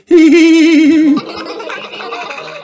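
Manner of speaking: put-on high, giggling children; laughter. A narrator's reading. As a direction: giggle with glee.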